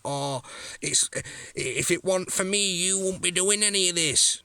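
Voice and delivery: mock the rival in a high put-on whine; mimicking his rival's boast in a high sneer; mocking whiny imitation. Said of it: "chat shit like".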